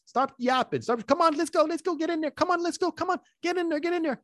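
high, excited chatter at the dog; imitating a worked-up owner urging the dog into the crate. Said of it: "And if you said excitement".

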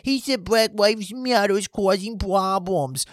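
high, slow mock-baby whine; mocking crybaby voice; whiny baby. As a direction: drag out the complaint like a sulky baby. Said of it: "You sounded like a goddamn baby".

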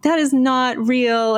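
slow, high-pitched mock scolding; mad dad. Daughter imitating dad upset about The Sopranos, mock mad. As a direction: lay it down slowly like an annoyed dad. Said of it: "he was so mad at me".

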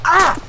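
sharp cry of pain, high. Acted. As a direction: cry out as the shot hits. Hit while running.

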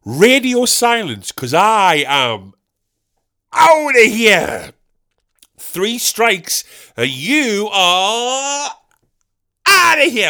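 drawn-out hammed-up umpire-style holler; impression of himself as lottery winner. Comic showing off his lottery win, hammed-up holler. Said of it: "My impression of me when I win the lottery".

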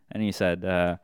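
normal talking voice

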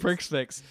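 "Prix Fix" with a ks closing both syllables; mispronounced prix fixe. Retold or imitated. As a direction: repeat "Prix Fix" as "pricks ficks". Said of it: "he says it completely wrong".

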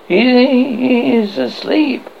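a stammered start, slow and high; stammering. A narrator's reading. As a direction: stumble over it, shaken. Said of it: "he stammered".

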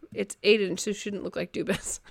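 reads "look like Dubas" quietly, then laughs at the slip; misread chat line. Real, not acted. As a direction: chat bericht verkeerd voorgelezen, zachtjes. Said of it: "It's Aiden who shouldn't like Dubas".

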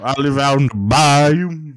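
slow, hammed-up Cajun drawl; mock Cajun bayou man; Cajun accent. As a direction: play a swamp-dwelling Cajun, slowly. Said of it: "and he's Cajun".

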